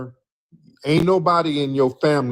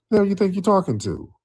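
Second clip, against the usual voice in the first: incredulous retort; 8 semitones higher than usual. Real, not acted. Host mocking caller's claim.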